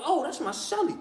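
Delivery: quiet, high-pitched aside to himself; soft realization. Retold or imitated. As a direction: let it dawn on you softly. Waking realization, said softly.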